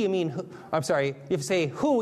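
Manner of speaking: normal talking voice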